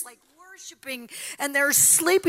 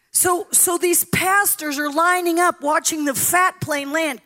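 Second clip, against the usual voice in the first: ordinary delivery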